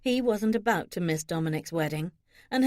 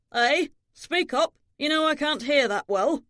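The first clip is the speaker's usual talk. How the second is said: high, raised voice of a deaf father; testy, higher-pitched call from someone hard of hearing; hard-of-hearing demand; 6 semitones higher, 4 dB louder than usual. Acted. Hard-of-hearing old father demands louder speech.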